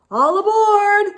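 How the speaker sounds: loud, high all-aboard call; trolley driver calling passengers aboard. A narrator's reading. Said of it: "the driver called out".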